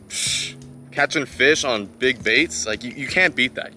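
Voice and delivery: normal talking voice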